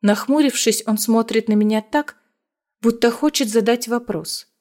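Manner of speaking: plain reading voice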